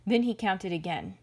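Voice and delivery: ordinary delivery, nothing marked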